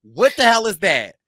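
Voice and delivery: loud, startled outburst; shocked shout. Retold or imitated. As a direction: blurt it out in shock. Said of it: "'Cause I was like".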